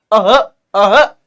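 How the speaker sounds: "अह" with the opening "अ" sounded before a breathy "ह"; audible leading अ. Shown as a demonstration. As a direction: repeat "अह" separately, giving "अ" then a puff of "ह". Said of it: "हमें अ बोलना पड़ रहा है". ("अह" में पहले "अ", फिर ज़ोर से "ह")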